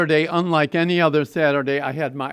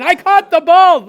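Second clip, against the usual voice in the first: high, loud triumphant cry; a kid yelling with pure delight after the catch; joyful shout; 13 semitones higher, 13 dB louder than usual. Retold or imitated. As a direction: shout it while jumping up and down. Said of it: "jumping up and down saying".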